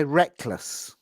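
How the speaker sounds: "reckless" said very slowly with a forward L; slow demo. Shown as a demonstration. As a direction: stretch out "Try reckless". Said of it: "Bring the tip of the tongue forward".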